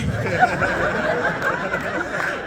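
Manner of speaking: high, breathy burst of laughter; giddy laugh. Real, not acted. Speaker laughing at herself before the crowd, giddy laugh. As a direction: laugh it off, caught by the spirit. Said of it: "I don't know what came over me".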